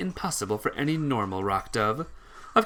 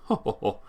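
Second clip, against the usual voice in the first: haughty laugh; 3 semitones lower than usual. Acted. Doctor gloats over the heroes.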